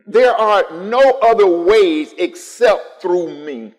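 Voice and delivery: loud, high and slow, every word hammered; slow emphatic preaching. Acted. Pastor insisting Jesus is the only way, slow loud preaching. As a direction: drive it home to the pews.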